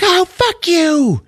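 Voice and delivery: very high, drawn-out mock scream; hammed-up outraged screech; startled screech. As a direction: shriek it back at the prankster. Startled lady swears at the prankster.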